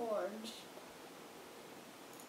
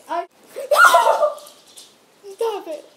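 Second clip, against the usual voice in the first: being tickled; 10 semitones higher, 14 dB louder than usual. Real, not acted.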